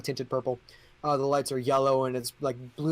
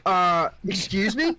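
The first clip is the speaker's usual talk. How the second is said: confused king; 6 semitones higher, 4 dB louder, slower than usual. Acted. King confused by bless you.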